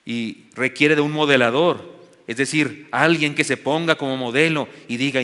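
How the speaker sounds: ordinary talk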